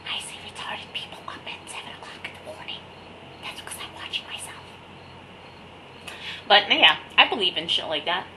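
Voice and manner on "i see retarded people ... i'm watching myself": spooky hushed whisper; eerie whispered movie line. Acted. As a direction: breathe it like a creepy movie kid. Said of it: "You mean dead people".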